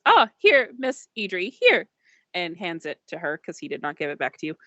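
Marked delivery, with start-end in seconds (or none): 0.1-1.9 higher, eager droid voice. Acted. Old droid eagerly handing the ticket over to the attendant. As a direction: perk up and hand it over. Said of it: "perks up and goes".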